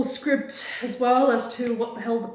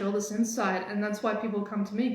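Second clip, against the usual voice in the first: ordinary delivery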